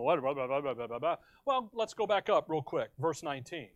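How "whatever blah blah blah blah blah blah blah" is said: low, softer, trailing gibberish; dismissive blah-blah. Acted. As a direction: gloss over it as meaningless noise. Skimming over confusing verse.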